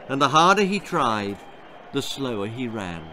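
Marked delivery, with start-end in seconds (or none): none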